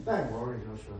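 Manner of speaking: soft low hushed voice of God; calm him like a gentle father; the Lord reassuring. Acted. The Lord reassuring Joshua before the battle, hushed and gentle. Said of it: "what does the Lord say to Joshua".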